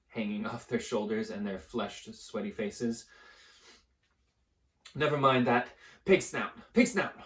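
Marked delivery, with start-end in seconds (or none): 4.9-7.1 loud, breathless rush; panting. Acted. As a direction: gasp out the password. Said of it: "panted Harry".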